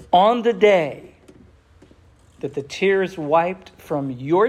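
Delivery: slow, weighty reading of the verse; slow and solemn, like reading from the pulpit; solemn scripture reading. Real, not acted. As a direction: read each phrase with reverent pauses.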